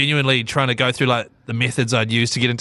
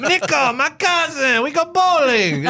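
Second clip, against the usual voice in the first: slow, high put-on Eastern European voice; drawn-out Roman cousin impression; Russian-style accent; slower than usual. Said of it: "call me on the phone and go".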